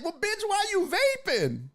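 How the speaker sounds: shrill teasing retort; sassy comeback. Retold or imitated. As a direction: toss it off like it's obvious. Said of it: "But I'm like".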